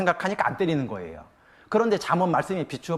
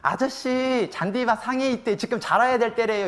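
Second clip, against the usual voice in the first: put-on child's voice; 6 semitones higher than usual. Child calling to man on lawn.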